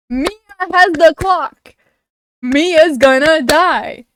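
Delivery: loud, slow, stressed alarm; heated dread. Real, not acted. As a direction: spell out the doom loudly. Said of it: "I'm getting heated".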